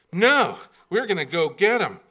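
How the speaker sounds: lightly voiced firm wife; imitating his wife. Wife arguing to fetch son.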